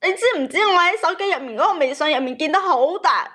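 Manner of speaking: usual speaking voice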